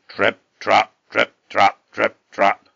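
loud, separated hoofbeat words; heavy stomping footsteps. A narrator's reading. Huge goat shaking the bridge, heavy stomping trip-trap. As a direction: stamp out each word loudly. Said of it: "his footsteps shook it".

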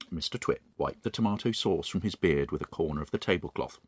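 quiet, soft narrator voice; story narration. A narrator's reading. Host reading The Twits aloud, soft narration. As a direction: drop back down to the gentle reader.

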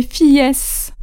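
"fillesses" with the plural S wrongly sounded; wrong plural ending. Shown as a demonstration. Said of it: "je ne vais pas entendre des".

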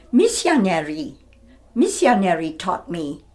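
put-on Siamese-accented English, lightly done; Lady Chiang accent. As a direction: retell her accented line, no diphthongs. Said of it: "that was an accent part".

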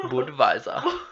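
laughing mock German Budweiser, rising; mock German accent. Friend teases with a laughing mock German Budweiser. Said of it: "a German accent".

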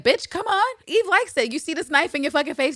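high, loud, hammed-up menace; mock threat. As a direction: snarl it like a playful killer. Villanelle threatening with a knife.

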